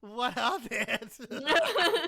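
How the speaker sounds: slow, loud laughing echo. Pretend. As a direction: laugh it back at her. Mocking echo while laughing.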